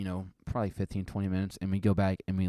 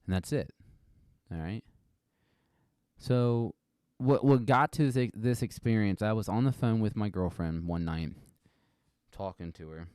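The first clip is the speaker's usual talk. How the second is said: ordinary talk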